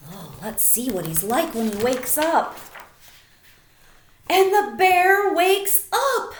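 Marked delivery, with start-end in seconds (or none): 4.3-6.3 slow, much louder and higher reveal; dramatic announcement. A narrator's reading. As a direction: announce it like a big surprise. Big reveal bear wakes.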